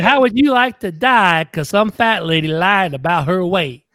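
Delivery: slow Southern comedian drawl; indignant folksy stand-up impression; James Gregory. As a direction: draw out the punchline, country style. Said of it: "That sound like him, Dave?".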